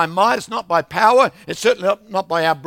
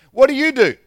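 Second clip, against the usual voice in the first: loud, higher, blunt question; barber's loud question; 8 semitones higher, 10 dB louder than usual. Retold or imitated. Lady barber demands loudly what he does. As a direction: call out over the clippers.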